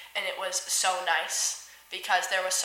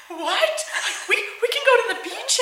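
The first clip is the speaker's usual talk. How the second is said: high, louder surprised question; imitated delighted disbelief; 13 semitones higher, 5 dB louder than usual. Staff hearing of a beach trip, excited disbelief. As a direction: act out friends who can't believe the offer.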